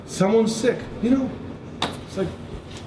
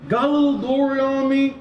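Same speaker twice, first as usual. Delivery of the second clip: normal talking voice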